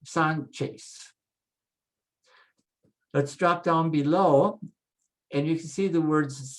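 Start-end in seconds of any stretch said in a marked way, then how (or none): 0.0-1.1 Sanchez as SAHN-ches, clear CH and final S; Spanish pronunciation. Shown as a demonstration. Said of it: "there's your che sound".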